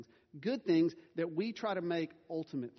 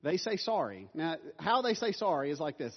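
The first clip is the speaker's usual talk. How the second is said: ordinary delivery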